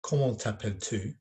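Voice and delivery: French read with an English accent, tu like "too". Shown as a demonstration. Teacher showing pupils the difference, English-accented French. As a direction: make "Comment t'appelles-tu?" sound English-accented. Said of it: "more of an English accent".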